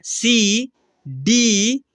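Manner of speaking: drawn-out letters read for the class; teacher reading small letters aloud; slow letter reading. Shown as a demonstration. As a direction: read the letters slowly, one at a time. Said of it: "क्या लिखा हुआ है यहाँ पर?".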